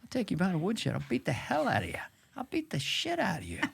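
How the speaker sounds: a hint of Biden bragging that he'll beat you up; Joe Biden impression. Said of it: "first he's like".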